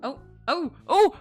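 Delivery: ordinary talk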